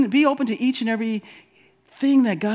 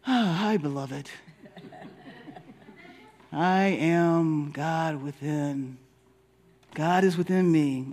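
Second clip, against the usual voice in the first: slow, hushed self-blessing, much softer; soft mirror affirmation; 6 semitones lower, 4 dB quieter, slower than usual. Pretend. Congregant in the mirror, softly blessing herself. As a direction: murmur it tenderly to yourself. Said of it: "we're gonna look in the mirror and go".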